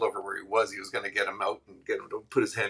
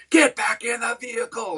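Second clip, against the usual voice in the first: much higher, louder barked order; retold cop yell; 6 dB louder than usual. Cop yells at Tony from behind the car, mock yell much higher. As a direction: bark the order like a cop over a speaker. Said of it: "he yells back".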